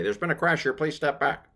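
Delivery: mock police officer warning; a playful cop telling people to step back. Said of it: "this little police line to say".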